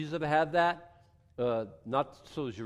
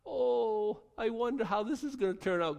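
mock wonder; 7 semitones higher than usual. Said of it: "God says".

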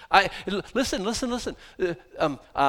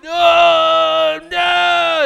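much higher, shouted no; mock Scottish lecturer; 12 semitones higher, 13 dB louder than usual. Scottish sermon preacher scolding listeners, mock shout. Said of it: "this guy would just lecture you".

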